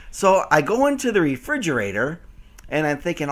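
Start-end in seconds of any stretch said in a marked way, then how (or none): none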